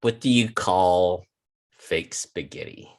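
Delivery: ordinary talk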